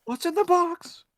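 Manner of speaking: very high, strained movie-quote wail; mock anguished cry. Co-host riffing on hiding in a box, mock anguish. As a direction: cry it out in hammed-up panic.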